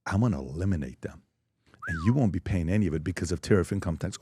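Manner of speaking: low, soft mock seduction; quietly teasing, hammed-up dirty talk. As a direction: murmur it low, as parody pillow talk. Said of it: "President Trump is talking dirty".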